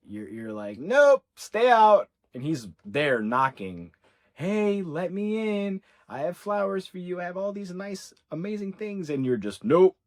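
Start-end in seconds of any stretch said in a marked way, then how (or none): 0.8-2.1 loud, high, drawn-out refusal; firm refusal. Acted. Woman keeping him locked outside her door, firm refusal. 4.3-9.1 high-pitched coaxing at the door; coaxing plea. Acted. Man knocking at her door with gifts, coaxing plea. Voices him pleading at door. 9.6-10.0 loud, high-pitched refusal; curt refusal. Acted. Woman refusing the man at her door, curt refusal.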